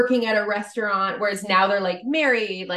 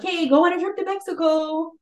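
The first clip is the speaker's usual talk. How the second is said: high, put-on friendly voice; mock chummy invite; 9 semitones higher than usual. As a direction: imitate fake friends making cheery plans. Voices fake friends planning trip.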